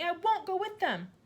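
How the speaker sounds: put-on high loud defiant girl; child shouting. A narrator's reading. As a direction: burst out in protest. Matilda voiced.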